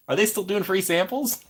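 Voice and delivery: laughing through a high question; giggling as she asks about samples. Real, not acted. To viewers about Ghirardelli, laughing.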